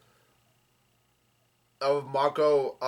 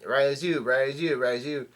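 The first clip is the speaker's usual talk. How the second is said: ordinary delivery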